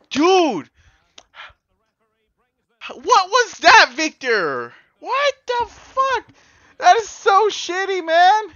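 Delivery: loud, high, slow outraged yelling; furious shouting. Real, not acted. Gamer raging after his penalty is saved, loud furious shout. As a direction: rage at the keeper who saved it.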